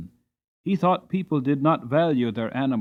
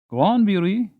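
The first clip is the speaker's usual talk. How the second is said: higher, louder urging of a horse; urging master; 8 semitones higher, 7 dB louder than usual. Acted. Master urging his horse onward, raised voice. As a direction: press the horse on from the cart.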